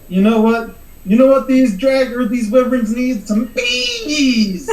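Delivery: loud, high, gleeful battle cry; excited character shout. Acted. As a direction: yell it out like a wild idea. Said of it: "He's gonna be like".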